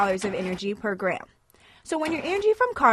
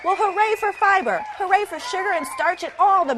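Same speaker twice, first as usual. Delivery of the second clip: cheering shout; 8 semitones higher than usual. Acted.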